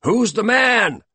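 loud, high, slow chant; rousing shout. A narrator's reading. Motivational chant during the exercise, loud shout. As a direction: yell it out to fire him up.

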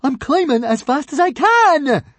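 high, loud, slow indignant shout. Acted. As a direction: shout up offended. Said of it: "shouted back up indignantly".

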